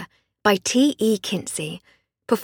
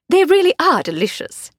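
higher, refined English lady; posh lady voice; 8 semitones higher, 5 dB louder than usual. Acted. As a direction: play the genteel lady of the house. Voiced Lady Hardcastle dialogue.

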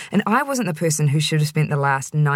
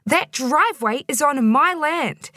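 higher, slow and insistent; indignant; 10 semitones higher, slower than usual. A narrator's reading. Angry caller insisting about her land. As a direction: lay down the claim.

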